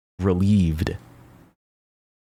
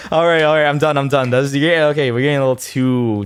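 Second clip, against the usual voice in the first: higher, louder, still laughing, calling it off; laughing wind-down; 7 semitones higher, 5 dB louder than usual. Real, not acted. Vlogger stopping himself after a risky joke, louder and laughing. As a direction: loudly settle yourself down mid-laugh.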